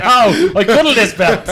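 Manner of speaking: high, loud, slow giggly mock tenderness; mock doting, laughing. Teasing a friend about his belt, laughing mock tenderness. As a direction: tease him by playing him laughing and doting. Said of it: "You said".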